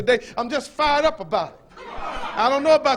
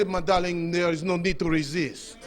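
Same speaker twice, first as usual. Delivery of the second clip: hammed-up French crooner; French accent; 4 semitones lower than usual. Preacher mimicking a B-movie lover to the congregation, in a French accent. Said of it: "he had a thick French accent".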